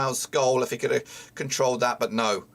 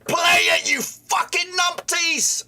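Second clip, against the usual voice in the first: angry shout; 14 semitones higher, slower than usual. Real, not acted. Fan shouting abuse at his own team's players. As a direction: rage at the players, slowing on the insult. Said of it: "I'm sorry, I hate my language".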